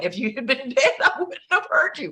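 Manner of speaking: high, laughing through the son's quip; laughing tease. Retold or imitated. Son teasing mom that he wouldn't have heard her, laughing.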